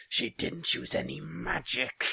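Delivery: high, loud, slow insistence; insistent shout. A narrator's reading. Father defending his daughter's honesty, insistent shout. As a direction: shout it out word by word.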